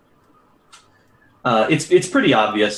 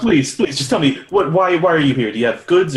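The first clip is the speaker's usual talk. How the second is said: raised, higher-pitched impatient shopkeeper; exasperated pleading; 6 semitones higher, 4 dB louder than usual. Acted. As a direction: beg them to get to the point. Shopkeeper impatient with rambling visitors.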